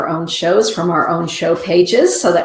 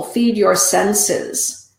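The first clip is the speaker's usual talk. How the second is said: slow emphatic; 3 semitones higher, much slower than usual. Pretend. Card message.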